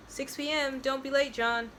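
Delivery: high, lightly called-out reminder; light yell. A narrator's reading. Kale reminding John about the party, light yell. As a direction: call it out high, without full volume. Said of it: "yells Kale".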